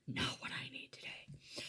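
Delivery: wry whisper to herself; whispered aside. Real, not acted. Tarot reader reacting to her own card, whispered. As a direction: mutter it under the breath, dryly.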